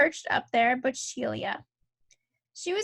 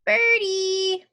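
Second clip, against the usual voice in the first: put-on high, loud, drawn-out toddler call; singsong little-kid calling; little boy calling; 6 semitones higher, 6 dB louder, much slower than usual. A narrator's reading. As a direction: call the birds like an eager small child. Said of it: "he called".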